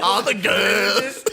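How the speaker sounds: put-on slow, strained Scottish "All the girls"; Scottish accent. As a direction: drag it out in a mock Scottish accent. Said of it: "It sounds like it hurts to talk".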